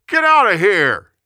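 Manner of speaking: loud, high angry order; shouting. A narrator's reading. Amnon turns on Tamar once he is done, angry shout. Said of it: "he shouted to his servants".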